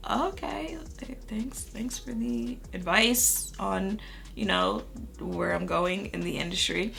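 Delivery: retold awkward reply, quiet and hesitant; taken-aback thanks. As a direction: stammer out doubtful gratitude, drawing it out. Acting out taken-aback reply to condescension.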